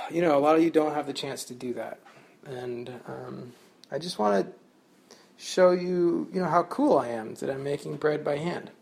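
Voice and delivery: usual speaking voice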